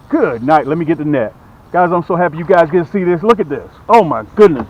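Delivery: normal talking voice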